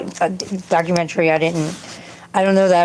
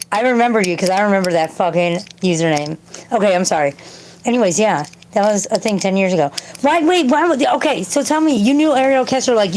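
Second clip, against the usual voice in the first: ordinary talk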